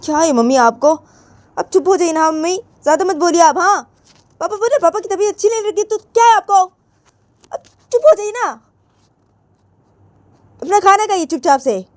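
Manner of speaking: high-pitched daughter snapping back; Poonam, exasperated. Acted. Daughter telling mummy to stop talking, exasperated. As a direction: scold back in a high, insistent voice.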